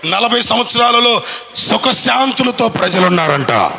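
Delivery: high, driving pulpit voice; fiery Telugu sermon delivery; full-force preaching. Acted. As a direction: preach it out to the whole congregation.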